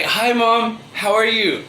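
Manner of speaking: a hint of an innocent kid; mock-innocent child greeting. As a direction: greet mom as if nothing happened. Muddy children greet mom innocently.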